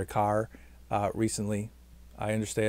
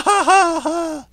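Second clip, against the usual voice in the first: loud squealing high laugh; 8 dB louder than usual. Real, not acted. Vlogger laughing at the name Baja, loud high laugh. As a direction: burst out in a silly high laugh. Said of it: "What a stupid laugh".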